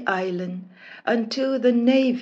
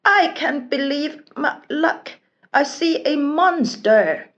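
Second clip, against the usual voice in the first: high, bright, eager voice; excited; 6 semitones higher, 5 dB louder than usual. Acted. Prince thrilled to meet a monster, high excited voice. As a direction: bounce with delight. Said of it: "He was so excited".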